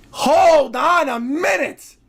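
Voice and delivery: slow, drawn-out hold on; drawn-out disbelief. Real, not acted. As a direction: stretch each word in disbelief. Pair with line 9.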